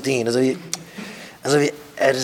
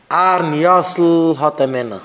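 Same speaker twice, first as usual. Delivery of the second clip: calling out announcement; 2 semitones higher, 4 dB louder than usual. Acted. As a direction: announce the name to the whole crowd. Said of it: "אזוי רופט מען אויס".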